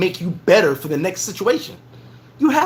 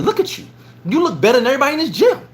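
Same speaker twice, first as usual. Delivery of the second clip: excited praise; 6 semitones higher, faster than usual. Retold or imitated. Mentor praising him, voiced.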